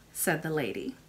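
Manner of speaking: usual speaking voice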